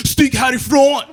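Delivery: high, loud Swedish command; hammed-up holler; mock shouted order. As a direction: act out yelling at an intruder to leave. Orders crackhead away in Swedish.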